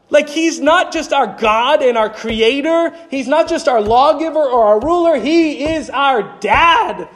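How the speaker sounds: high, fervent preaching, the last words slowed. Acted. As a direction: build to the word dad.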